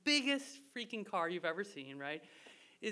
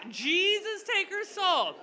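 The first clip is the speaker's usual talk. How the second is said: "Jesus, take her soul" higher and louder, laughing at it; mocking prayer; 11 semitones higher, 8 dB louder, slower than usual. Mocking the stranger's prayer to the audience, higher laughing voice. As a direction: send up the stranger's dramatic prayer.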